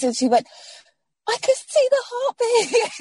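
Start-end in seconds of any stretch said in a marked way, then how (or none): 1.3-2.9 joyful announcement. Retold or imitated. Doctor announces heartbeat during scan.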